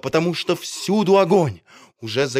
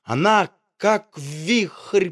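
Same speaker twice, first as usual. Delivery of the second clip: ordinary delivery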